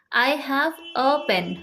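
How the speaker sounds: very slow, each word laid out clearly; slow model sentence. Shown as a demonstration. Teacher modelling an English sentence for students, very slow and clear. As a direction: spell out I have a pen slowly for learners. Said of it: "हम English में क्या बोलते हैं?".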